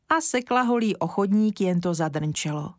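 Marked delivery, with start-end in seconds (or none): none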